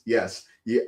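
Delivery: normal talking voice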